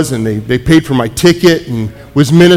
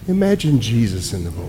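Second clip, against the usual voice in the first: soft guiding voice; 5 semitones lower, 9 dB quieter than usual. Retold or imitated. Counselor guiding the session.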